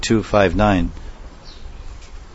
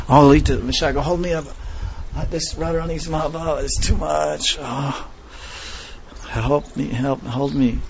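weak swooning plea; 6 semitones higher, 5 dB quieter than usual. Acted. Krishna's swooning plea voiced.

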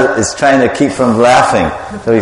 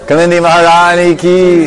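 higher, louder call for the response; devotional call; 6 semitones higher, 3 dB louder than usual. Real, not acted. Leader calling the temple crowd to answer Jai.

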